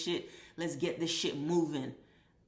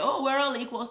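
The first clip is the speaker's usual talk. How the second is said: pitched higher, mocking and syrupy; 8 semitones higher, 3 dB louder than usual. Mocking the last-place student's plea for equality, higher and sneering. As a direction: sneer it out like a false promise. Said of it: "So they wanna share".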